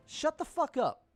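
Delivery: high yelled shut the fuck up; angry shout. Real, not acted. Streamer yelling at Elon Musk over tweets, high angry shout. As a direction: blast it at him, pitch jumping up.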